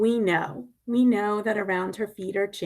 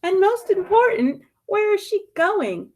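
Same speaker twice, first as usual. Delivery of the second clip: excited question; 10 semitones higher, 7 dB louder than usual. Acted. Rhetorical climax in read-aloud.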